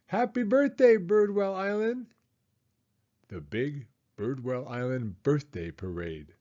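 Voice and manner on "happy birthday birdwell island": much higher, calling from cars; shouted greeting. Acted. Drivers shout birthday wishes at the kids, shouting. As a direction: shout happy wishes to the parade. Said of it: "some even shouted".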